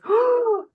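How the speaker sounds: sharp high shocked gasp; one big collective gasp of horror; class gasp. Retold or imitated. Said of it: "And the whole class goes".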